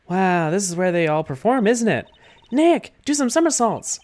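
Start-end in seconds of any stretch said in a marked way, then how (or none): 2.5-4.0 put-on higher, bright-eyed young sidekick; cheerful girl voice. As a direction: pitch it up and pitch an idea eagerly. [name] teasing Nick.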